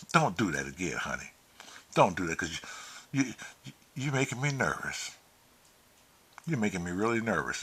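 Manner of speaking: soft, subdued plea after scolding; hushed pleading. Retold or imitated. Man begging his companion not to wander off, soft and subdued. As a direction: drop to a quiet, worried appeal.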